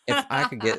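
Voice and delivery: Southern accent, hammed up high. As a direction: ham up a Southern villain. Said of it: "I'm suddenly going Southern".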